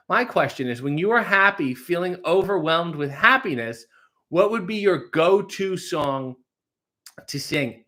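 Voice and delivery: [name]'s mock listener voice. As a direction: lightly ham up an eager new fan. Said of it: "[name]'s doing a bit".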